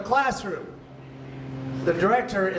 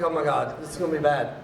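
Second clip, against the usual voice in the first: low, resigned aside; a low groan of mild dread; sinking dread; 6 semitones lower, faster than usual. Retold or imitated. Comic retelling his dread as director films class, sinking dread.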